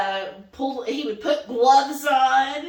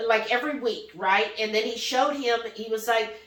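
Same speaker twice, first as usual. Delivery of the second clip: normal talking voice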